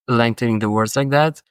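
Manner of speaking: normal talking voice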